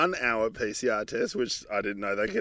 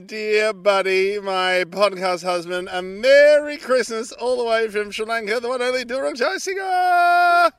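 announcer intro; 7 semitones higher, 5 dB louder, slower than usual. Acted. Grand podcast host introduction.

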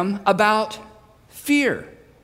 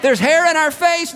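high, comic shout over the wind; raised, pitched-up yell; yelled back; 8 semitones higher, 4 dB louder than usual. Acted. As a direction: shout the complaint back through the storm. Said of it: "Everyone is yelling".

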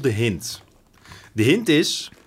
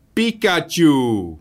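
squeaky Pikachu shout; 5 semitones higher, 6 dB louder, much slower than usual. Retold or imitated. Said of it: "met een piepstemmetje hier doorheen schreeuwt".

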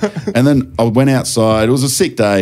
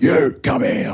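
higher, stern mock cop call; copper's order; 8 semitones higher than usual. Coppers spot him after the cartwheels, stern mock order. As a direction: bark it like a copper nabbing him. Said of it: "the coppers went".

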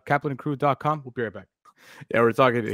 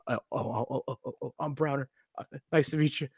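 softer, stumbling mock-nervous stammer; flustered stammer; 6 dB quieter than usual. Host imitating Browner meeting Mina Kimes, mock flustered stammer. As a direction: fumble the words like a starstruck fan. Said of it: "this fool was just so flustered".

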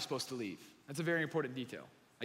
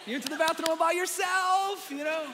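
put-on high, loud cheering like praising a toddler; mock cheering wife; 14 semitones higher, 4 dB louder than usual. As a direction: squeal it like a proud mom at potty training. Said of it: "She's like".

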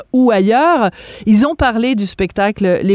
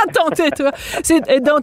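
laughing, higher-pitched reaction; amused laughter; 5 semitones higher than usual. Real, not acted. Host reacting to guest's praised Québécois accent, laughing. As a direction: talk through a burst of laughter.

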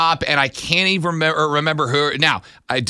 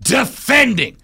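slow, drawn-out wrestling announcer intro; ring announcer; 2 semitones higher, much slower than usual. Acted. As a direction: build it up and shout the name.

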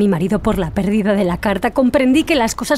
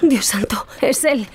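urgent frightened whisper; 4 semitones higher, slower than usual. Acted. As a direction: breathe it so the husband can't hear. Husband arrives, hides.

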